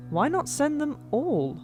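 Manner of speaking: usual speaking voice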